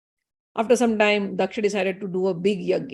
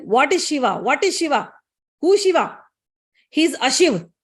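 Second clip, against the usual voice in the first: indignant retold outburst, higher and louder at its peak; angry; 4 semitones higher, 4 dB louder than usual. Daksha answering his daughter about her husband, angry. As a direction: scoff as an enraged father-in-law. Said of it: "Daksha got angry".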